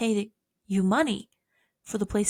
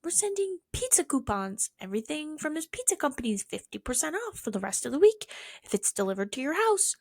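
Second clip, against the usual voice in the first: mock ad voice; 7 semitones higher than usual. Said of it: "I get emails about stupid shit like".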